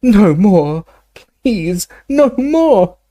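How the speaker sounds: high, drawn-out begging cry; anguished yell. A narrator's reading. Dumbledore yelling as his insides burn, high anguished cry. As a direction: cry out high, dragging out the plea. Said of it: "he yelled again".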